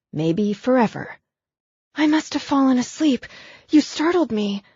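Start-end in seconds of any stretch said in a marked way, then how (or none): 1.9-4.6 higher, anxious woman's voice; a lightly voiced worried young woman; Abigail's voice. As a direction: voice her shaken and apologetic.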